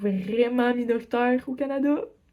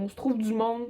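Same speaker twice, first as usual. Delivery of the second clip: usual speaking voice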